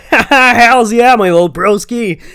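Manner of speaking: very high, loud American whoop; hyped loud American bro yelling; rowdy party shout. A narrator's reading. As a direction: shout it out like a hyped frat boy. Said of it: "the fat guy nodded in approval".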